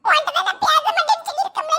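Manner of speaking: normal talking voice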